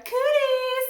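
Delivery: high, loud shrieked taunt; shrill playground shout; hollered taunt. Acted. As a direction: screech it across the playground. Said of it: "sneak up on Alice at recess and holler".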